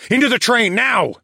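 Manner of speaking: loud urgent command; shouting. A narrator's reading. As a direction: yell it while running. Fleeing T-Rex, urging Becca.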